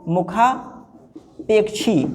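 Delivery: मुखापेक्षी drawn out slowly and softly, mu-khaa-pek-shee; slow dictation. Shown as a demonstration. Teacher answering a student, slow and soft. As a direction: say मुखापेक्षी slowly so it can be copied. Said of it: "क्या होता है भैया?".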